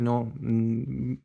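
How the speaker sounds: ordinary talk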